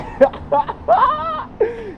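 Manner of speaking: bright high burst of laughter. Real, not acted. Laughing after singing the Jeffersons theme to himself. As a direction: laugh at his own singing.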